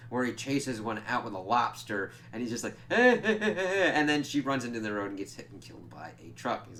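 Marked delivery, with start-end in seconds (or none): 2.9-3.9 high, loud mock chuckle; hammed-up chasing laugh in short bursts; imitated cackle. Imitates character's laugh during chase.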